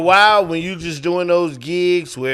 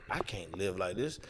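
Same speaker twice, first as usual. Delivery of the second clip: much softer, lower defeated line; despairing murmur; 9 semitones lower, 15 dB quieter than usual. Retold or imitated. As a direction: say it to yourself, worn down. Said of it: "you's like".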